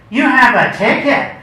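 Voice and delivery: high, loud wail against injustice. Acted. Envious of Vera's ticket, a loud wail. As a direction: cry it out as an outrage. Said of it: "less a statement than a wail".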